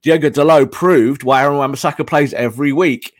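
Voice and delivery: ordinary talk, nothing marked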